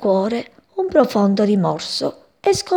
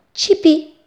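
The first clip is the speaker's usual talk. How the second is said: ordinary delivery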